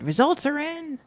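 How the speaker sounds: high mock hype-man voice; mocking his post. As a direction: read his boast in a high sneering voice. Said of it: "this is what he posted".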